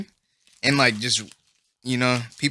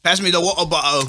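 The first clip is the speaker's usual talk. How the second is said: British "water bottle" with dropped t's; mock British accent. Friend mocking British talk, British accent. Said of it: "they sound so dorky".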